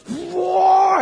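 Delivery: hammed-up otherworldly whoa; crazy voice. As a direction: shriek it like a transformed elf queen. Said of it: "speaking in this crazy voice".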